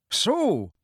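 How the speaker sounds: high-pitched, nasty "so"; pointed and unpleasant; nasty inflection. A narrator's reading. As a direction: sneer it on a high falling note. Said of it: "with a nasty inflection in his voice".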